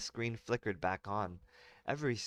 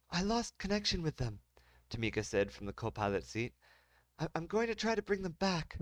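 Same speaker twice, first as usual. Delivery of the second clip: co-pilot Tamika; 8 semitones higher than usual. Acted. Tamika voiced higher.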